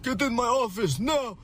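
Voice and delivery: very high mock Russian-accented order; Russian boss. Boss summoning me to his office, high mock Russian accent. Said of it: "they were like".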